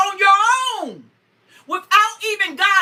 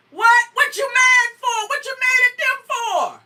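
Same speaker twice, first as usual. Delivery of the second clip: incredulous questioning; 6 semitones higher than usual. Acted. Mock questioning a debtor.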